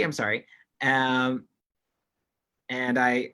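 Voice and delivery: ordinary talk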